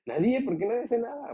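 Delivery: voz chillona mucho más aguda, en burla; animalistas burlados. Retold or imitated. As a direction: exagera a los animalistas indignados. (animalista imitado con voz muy aguda y quejumbrosa)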